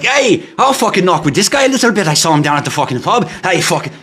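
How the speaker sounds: hammed-up Irish pub fighter; Irish boxer accent. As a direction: put on a rowdy, high-pitched Irish accent. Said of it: "Irish Boxer".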